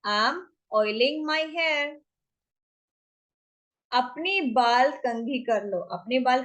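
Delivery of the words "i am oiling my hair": drawn-out teaching delivery; slow demo phrase; slow model phrase. Shown as a demonstration. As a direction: stretch it so learners can follow. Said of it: "तो क्या आएगा".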